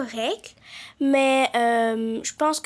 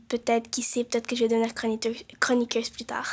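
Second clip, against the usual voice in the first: whispering; 3 semitones lower, 4 dB quieter, faster than usual. Real, not acted. Shy hope, trails to whisper.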